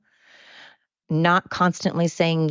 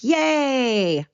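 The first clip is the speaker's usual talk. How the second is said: high, drawn-out celebratory yay; mock celebration; 9 semitones higher than usual. Speaker celebrating taking out the garbage, mock cheering. Cheering own tiny chore.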